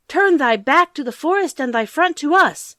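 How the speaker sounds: put-on high, loud children's command to the hut; shrill childish call. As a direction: order the hut loudly like small children. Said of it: "shrill, childish voices they called out loud".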